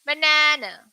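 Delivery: banana said as buh-NAH-nuh, stress on the middle syllable; correct pronunciation. Shown as a demonstration. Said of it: "how is correct way we want to pronounce".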